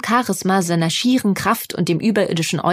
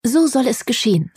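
Dienerstimme; 4 semitones higher than usual. A narrator's reading. Der Diener verbeugt sich.

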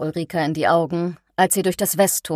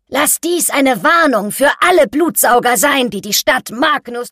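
laut und deutlich höher ausgerufen; laute Warnung; 6 semitones higher, 5 dB louder than usual. A narrator's reading. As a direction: der Menge eine Warnung verkünden. Ausrufer warnt die Menge am Scheiterhaufen. (mit erhobener Stimme öffentlich gewarnt)

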